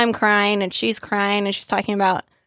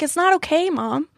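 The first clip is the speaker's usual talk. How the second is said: put-on child crying; 8 semitones higher than usual. Child crying over homeless woman.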